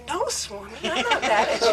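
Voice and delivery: melting as she tells him she isn't mad; tender reply. Retold or imitated. Devotee answering Swami tenderly, voice lifted.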